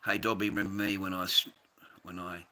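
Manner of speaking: ordinary talk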